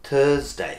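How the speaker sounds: "থার্সডে" as thuhz-day, R barely there; soft R. Shown as a demonstration. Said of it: "এরকম না". ("থার্সডে"-তে র খুব হালকা)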